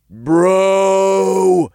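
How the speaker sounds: long, loud, high whine; whining. A narrator's reading. Imp complains to his bro, whining. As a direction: draw it out in high protest. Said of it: "the imp whined".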